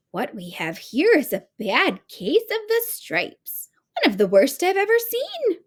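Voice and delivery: high, sweet grandma voice rising in alarm; kind old woman. A narrator's reading. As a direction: fuss over it like a kindly granny. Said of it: "the kind old woman".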